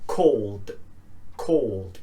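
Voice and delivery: "called" as one syllable, L running into d; D ending. Shown as a demonstration. Teacher modelling the voiced ending, "called" as cawld. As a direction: say "called" as cawld, no extra vowel. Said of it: "Not call it".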